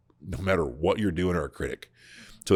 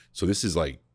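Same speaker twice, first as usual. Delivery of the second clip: ordinary talk, nothing marked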